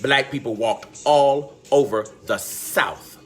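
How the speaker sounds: low, subdued testimony; solemn, overcome. Real, not acted. Host telling of sold-away families, solemn and low. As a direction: bear witness low and heavy.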